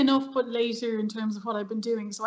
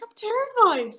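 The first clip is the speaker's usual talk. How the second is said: retold terror, pitched much higher; mock terrified; 12 semitones higher, 4 dB louder than usual. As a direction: squeak out the confession in mock panic. Admitting nerves at first gig.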